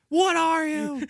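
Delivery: loud high demanding chef; barked mock interrogation; mock Ramsay bark. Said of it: "lamb sauce".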